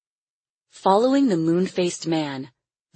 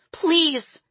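put-on high, pleading child's voice; crying out; 12 semitones higher than usual. A narrator's reading. Caged girl begs her captor, crying out. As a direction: plead in a high little girl's cry. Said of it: "she cried".

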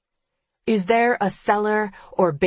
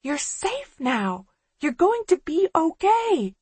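manic reassurance; 10 semitones higher than usual. A narrator's reading. Killer reassuring hysterically.

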